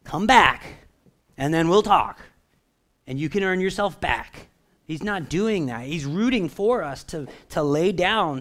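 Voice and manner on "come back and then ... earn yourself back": louder, stern and distant; a grudging father holding back love; cold conditional voice. Shown as a demonstration. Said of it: "I'm gonna do this for you to see".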